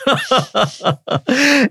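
high, real burst of laughter; hearty laugh. Real, not acted. Professor laughing at host's quip, high laugh. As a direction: let the laugh roll out.

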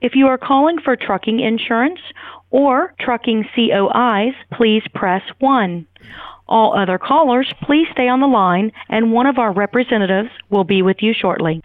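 recorded phone menu announcement. Real, not acted. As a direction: read it like an automated hold message. Automated insurance agency phone greeting.

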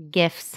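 "gifs" with g as in gift; hard g. Shown as a demonstration. Co-host insisting on her way, "gifs" with hard g. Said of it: "No, it's gifs".

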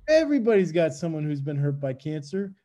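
ordinary talk

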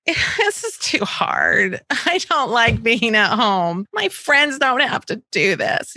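slow, whining daughter's complaint; whiny child. Retold or imitated. Daughter complaining about schoolwork, slow and whiny. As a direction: drag it out like a sulky kid. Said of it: "actual things that she said to me".

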